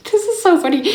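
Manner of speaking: high, giggly, caught off guard; laughing. Retold or imitated. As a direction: laugh the words out in surprise. Laughing at the blunt question.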